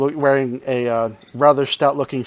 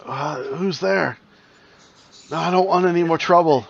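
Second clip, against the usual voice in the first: frightened voice; 5 semitones higher than usual. Acted. Wounded NPC Gretel answers.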